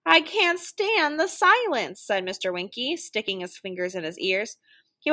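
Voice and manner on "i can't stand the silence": slow, high-pitched protest; long, raised storybook lament; exasperated complaint. A narrator's reading. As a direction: wail it out, drawn long. Character protests, fingers in ears.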